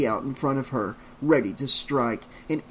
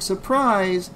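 mild, raised-pitch yell; 6 semitones higher, 4 dB louder than usual. A narrator's reading. Trish pictures teenagers jumping out of hiding, mildly yelled surprise. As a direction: pop out with a light shout.

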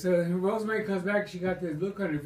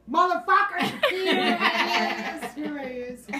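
excited shout; 8 semitones higher, 3 dB louder, slower than usual. Retold or imitated. Schoolmates greet him loudly.

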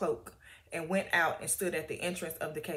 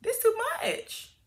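honest complaint to God; 13 semitones higher than usual. Retold or imitated. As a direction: let it all out like someone at the end of their rope. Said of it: "we're being real honest by saying".

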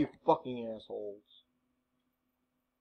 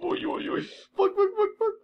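high, panicked string of curses; panicked swearing. Real, not acted. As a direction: yelp it out in a high-pitched panic. Panics after skipping the wrong person.

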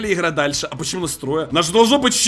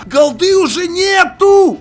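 shout; 9 semitones higher, 4 dB louder, slower than usual. Real, not acted. Gamer panics, no money.

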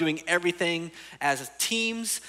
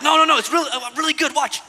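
loud, higher mock shout of an eager kid; kid insisting; 8 semitones higher, 9 dB louder, faster than usual. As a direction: beg the parents to watch, loudly.

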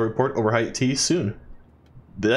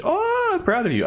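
gushing pride. Acted. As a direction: coo it high like a proud friend. Gushes while reading Chloe's text.

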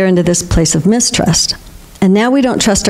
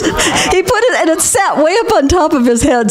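high giggling through the words; laughing; 9 semitones higher than usual. Real, not acted. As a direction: laugh as you tell it. Laughing at husband's hat.

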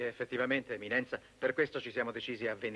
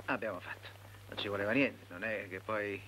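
ordinary talk, nothing marked

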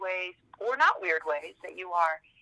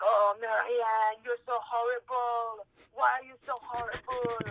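mock French accent, slow and higher; French teacher accent; 5 semitones higher, slower than usual. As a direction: imitate a French clown teacher scolding, drawn out in a French accent. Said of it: "I won't do his French accent again".

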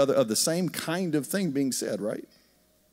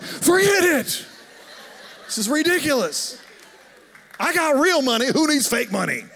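hammed-up board-game rage; mock outburst; 11 semitones higher than usual. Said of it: "you're like".

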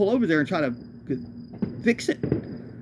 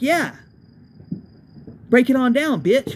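higher, louder taunting sneer; mock snide jeer; 7 semitones higher, 4 dB louder, slower than usual. A van mate mocking the narrator, a hammed-up taunt. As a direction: jeer at him like a bully. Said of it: "he kinda got shitty with me".

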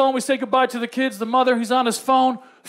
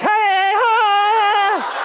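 cutesy K-pop; 8 semitones higher, 3 dB louder than usual. Retold or imitated. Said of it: "Korea's like".

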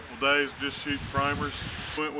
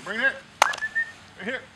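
high coaxing call; 8 semitones higher, 4 dB louder, faster than usual. Real, not acted. Calling puppy to bring bumper.

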